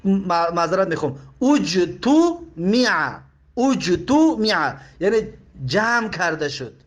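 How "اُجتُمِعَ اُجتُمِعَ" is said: اجتمع drawn out as uj-tu-mi-'a; very slow اجتمع, each syllable apart; passive vowels. Shown as a demonstration. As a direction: sound out اجتمع with u, u, then i.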